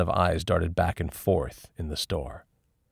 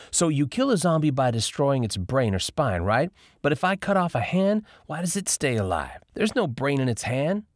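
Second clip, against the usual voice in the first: higher friend voice working out a riddle; lightly voiced Dion, puzzling; 8 semitones higher than usual. Dion quizzing the others about severed zombie hands. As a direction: voice her like she's cracked a puzzle.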